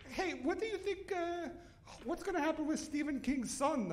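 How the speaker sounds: high put-on old lady voice; high gossipy granny voice; mother-in-law voice.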